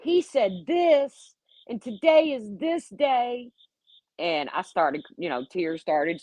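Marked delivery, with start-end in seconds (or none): 0.0-3.5 put-on slow, high, tearful toddler whine. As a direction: drag it out high like a weepy little kid. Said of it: "like a toddler".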